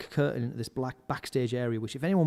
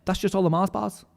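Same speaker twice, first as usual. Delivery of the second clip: high, louder acted nonchalance; breezy reassurance; 6 semitones higher, 5 dB louder than usual. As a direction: brush off the worry, mock-cheerful. Roadie breezily reassuring the worried narrator.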